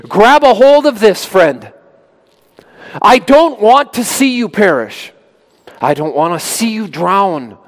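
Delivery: high, loud call to a drowning swimmer; lifeguard calling out. Retold or imitated. Lifeguard calling out to a drowning swimmer, urgent plea.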